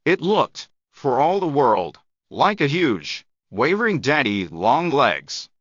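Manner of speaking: usual speaking voice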